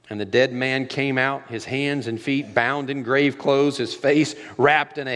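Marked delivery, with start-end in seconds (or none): none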